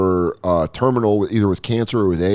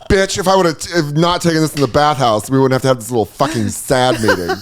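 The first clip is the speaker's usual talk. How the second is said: higher-pitched sassy clapback; 3 semitones higher than usual. Acted. Bathhouse legend snapping at the therapists, sassy. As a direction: open high on a sassy put-down, then ease down. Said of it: "He's still sassy".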